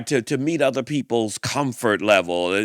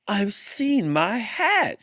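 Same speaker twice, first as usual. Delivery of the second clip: higher, slow, wide-eyed gasp of discovery; dawning realization; 8 semitones higher, slower than usual. Acted. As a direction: draw out the sudden recognition. Bear suddenly realizes.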